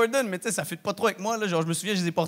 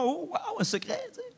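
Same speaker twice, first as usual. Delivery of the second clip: put-on childlike wonder; 12 semitones higher, 8 dB quieter than usual.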